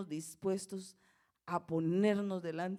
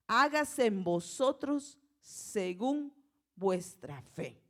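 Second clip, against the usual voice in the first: solemn pronouncement; 6 dB louder, much slower than usual. A narrator's reading. Jesus touching the blind men's eyes, slow solemn decree. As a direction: pronounce it word by word with authority. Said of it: "les tocó los ojos diciendo".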